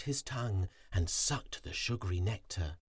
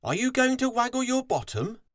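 loud, quick, very high question; high, brisk shouted question; fox calling out; 6 dB louder, faster than usual. A narrator's reading. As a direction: call it out eagerly as the fox. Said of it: "Fox looked up from digging and called".

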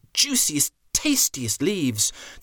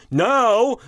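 chief elephant voice; 9 semitones higher, 6 dB louder than usual. Acted. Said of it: "said the chief elephant".

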